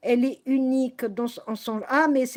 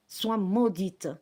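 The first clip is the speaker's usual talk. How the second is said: slow solemn curse; 6 semitones lower, 3 dB quieter, much slower than usual. Real, not acted. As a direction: drop low and slow, lay the curse.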